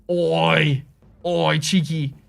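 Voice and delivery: drawn-out mock Aussie-Brit accent; playful put-on Australian-leaning British; British turning Australian. Shown as a demonstration. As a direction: stretch the vowels in a British-Australian caricature. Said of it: "I feel like that's Australian at this point".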